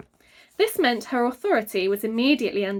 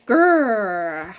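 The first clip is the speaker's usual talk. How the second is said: creature growl; 6 dB louder than usual. A narrator's reading. The creature Fing growls at Father. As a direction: growl like a small angry creature. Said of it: "Fing didn't like that".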